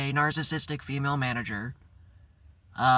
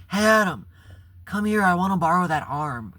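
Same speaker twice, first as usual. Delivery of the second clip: mimicking the boss, higher and louder; a hint of the bossy manager calling him over; 4 semitones higher, 5 dB louder than usual. The bossy manager calling him over, a hint of loud and high.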